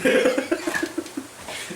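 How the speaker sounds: high, wheezing laugh; breathy laugh. Real, not acted. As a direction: laugh it out with hardly any voice. Laughs after the interruption.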